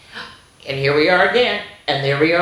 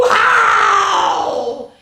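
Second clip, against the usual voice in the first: high, loud howling werewolf; 6 dB louder than usual. A narrator's reading. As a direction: throw your head back and howl. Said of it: "Werewolf leaps out".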